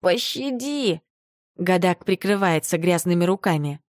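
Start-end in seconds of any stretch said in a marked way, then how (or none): 0.0-1.0 slow, drawn-out desperate plea; pleading. A narrator's reading. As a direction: beg for mercy, whimpering. Beaten little man begs mercy.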